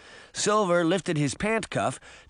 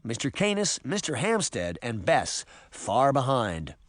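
ordinary delivery, nothing marked

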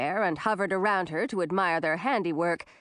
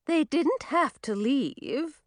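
scowling queen; 6 semitones higher, slower than usual. A narrator's reading. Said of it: "Queen Frizella scowled at Millie".

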